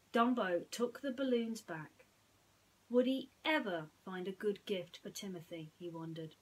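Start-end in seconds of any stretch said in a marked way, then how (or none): none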